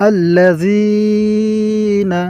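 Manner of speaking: আল্লাযীনা with the যী stretched slowly; lengthened vowel. Shown as a demonstration. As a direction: hold the যী of আল্লাযীনা. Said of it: "এই জি এখানে আমাদের টান দিতে হবে".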